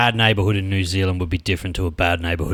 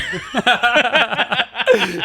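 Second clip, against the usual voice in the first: high, breathy nervous giggle; nervous laughter; 13 semitones higher than usual. Real, not acted. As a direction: laugh it off, uneasy and awkward. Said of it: "I'm laughing 'cause I'm nervous".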